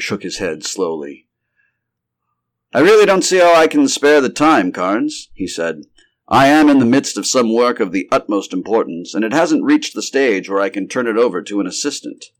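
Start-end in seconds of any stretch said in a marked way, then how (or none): 2.7-12.3 emphatic, higher-voiced refusal; protesting doctor. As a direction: protest that you are far too busy. Busy doctor protests he cannot go.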